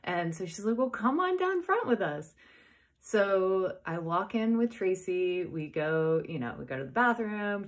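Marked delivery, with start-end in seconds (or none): 0.8-2.3 high, loud beckoning call; enthusiastic invitation. Retold or imitated. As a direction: urge a friend along eagerly. Friend invites her down front.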